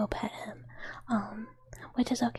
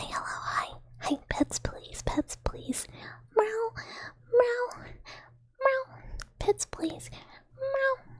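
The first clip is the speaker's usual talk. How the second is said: needy cat voice; 5 semitones higher than usual. Retold or imitated. Imitating needy Mister Meow Meow begging for pets, high meowing whine. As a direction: beg for pets like an attention-starved cat. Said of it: "he's like".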